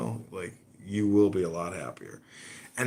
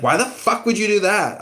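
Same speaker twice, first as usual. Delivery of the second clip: higher, loud outraged yell; outraged friends; 12 semitones higher, 8 dB louder than usual. Retold or imitated. Friends yelling at John for cancelling the Uber, outraged. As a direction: blow up at him in disbelief.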